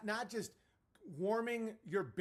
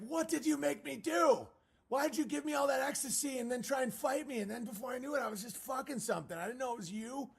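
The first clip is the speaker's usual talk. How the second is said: mock confused guy; 6 semitones higher, faster than usual. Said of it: "It's like".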